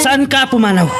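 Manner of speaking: pleading shout, voice cracking high; desperate cry. Acted. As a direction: call out to stop her leaving. Quarrel, begging her to stay.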